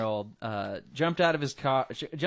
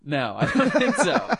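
laughing; 11 semitones higher, 7 dB louder than usual. Real, not acted. Laughs at absurd question.

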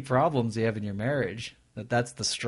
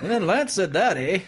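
put-on Canadian accent; 7 semitones higher than usual.

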